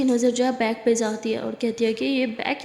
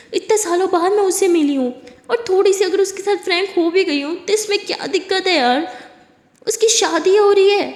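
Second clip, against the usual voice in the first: raised, higher pitched scolding; heated argument; 5 semitones higher than usual. Acted. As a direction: push back hard, voice climbing. Heated quarrel about jealousy.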